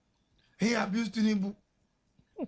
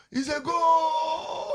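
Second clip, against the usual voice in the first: much higher, louder, slow drawn-out "goal" cry; imitated goal shout; 8 semitones higher, 5 dB louder, much slower than usual. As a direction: bellow it like a crowd at a goal. Crowd cheering at the stadium.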